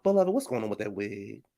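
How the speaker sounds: hushed judgy church lady; Ayanna. Retold or imitated. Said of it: "first thing come out Ayanna mouth".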